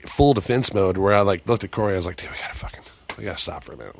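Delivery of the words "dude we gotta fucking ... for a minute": near-whispered plea to a friend, retold; hushed urgent aside. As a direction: drop to a low, very soft undertone. Retelling urging his friend to stop.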